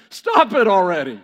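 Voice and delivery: high, loud mock plea; hammed-up outburst of being fed up; exasperated protest. Gideon begging God to stop cutting men, exasperated.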